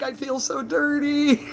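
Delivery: high, drawn-out comic whine; mock self-disgust. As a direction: wail it slowly for comic effect. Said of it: "And I'm like".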